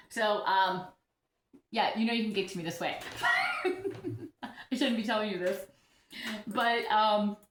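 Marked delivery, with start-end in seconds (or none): 3.0-4.4 breathy high giggle. Real, not acted. Giggling to her viewers about the rude comment. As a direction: giggles to herself over the comment.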